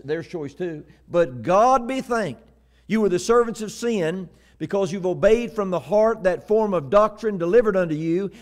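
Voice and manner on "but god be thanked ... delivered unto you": raised, ringing recitation; preacher quoting scripture. Acted. Preacher quoting Paul to congregation, declaiming. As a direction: declaim the verse with force.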